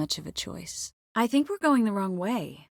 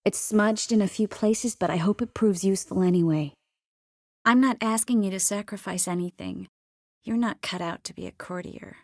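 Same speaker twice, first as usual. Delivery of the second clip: ordinary delivery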